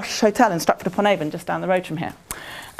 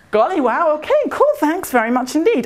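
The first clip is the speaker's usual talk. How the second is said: high, chirpy American thanks; American accent; 6 semitones higher than usual. Retold or imitated. American tourist politely thanking the waitress, high American accent.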